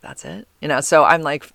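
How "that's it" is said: barely voiced, let down; deflated whisper. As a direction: breathe it out, deflated. Repeats disappointed reaction.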